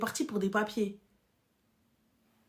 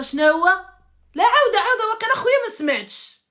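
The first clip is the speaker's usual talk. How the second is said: loud, high, fast angry yell; ماكاينش الهدرة بشوية، غوت بالغضب; angry outburst; 10 semitones higher, 13 dB louder, much faster than usual. Pretend. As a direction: snap at him like a furious wife. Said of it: "je me transforme en Kazaouia".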